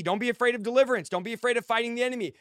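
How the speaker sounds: usual speaking voice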